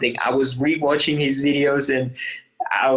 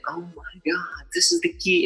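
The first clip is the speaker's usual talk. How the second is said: hushed then higher eureka; sudden realization; 5 semitones higher, 3 dB quieter than usual. Retold or imitated. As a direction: breathe out the discovery. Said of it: "I was like".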